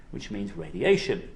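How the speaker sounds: ordinary talk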